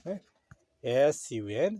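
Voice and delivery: ordinary delivery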